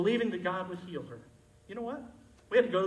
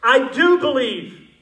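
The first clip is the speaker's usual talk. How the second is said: loud, slow, drawn-out cry; desperate father crying out; 5 semitones higher, 11 dB louder, slower than usual. Shown as a demonstration. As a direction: cry it out with everything. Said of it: "it means to croak or scream or call out".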